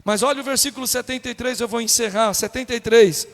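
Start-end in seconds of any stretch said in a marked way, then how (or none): none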